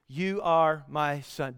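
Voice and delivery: slow reminder. Acted. The Father reminding Jesus who he is, slow and soft. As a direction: let each word land slowly and quietly. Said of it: "he reminds him who he is".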